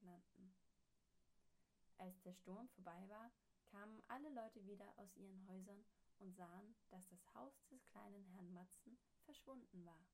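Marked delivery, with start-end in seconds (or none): none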